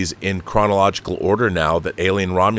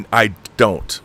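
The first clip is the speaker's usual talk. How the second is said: ordinary talk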